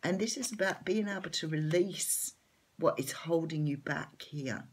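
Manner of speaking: normal talking voice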